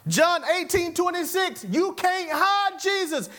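high, projected pulpit delivery; forceful preaching. Real, not acted. Preacher citing John to the congregation, forceful preaching. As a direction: proclaim the verse high and drawn out.

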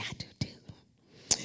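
breathy whispered aside; hushed confiding whisper. Real, not acted. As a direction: drop to a whisper.

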